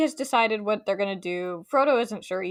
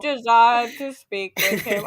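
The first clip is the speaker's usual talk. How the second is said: robot voice; 3 semitones higher, 5 dB louder than usual. Retold or imitated. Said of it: "he sounds like a robot".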